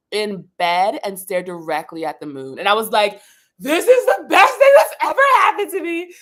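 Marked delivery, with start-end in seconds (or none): 3.6-6.1 overjoyed. Retold or imitated. First full moon from bed.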